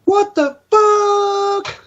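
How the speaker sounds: very high, slow stretched squeal; furry voice. Pretend. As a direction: pitch way up and drag it. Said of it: "He was close".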